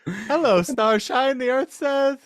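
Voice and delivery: hammed-up high singsong greeting; whimsical high quote. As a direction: float it up high like a dreamy storybook song. Quoting the Willy Wonka song line.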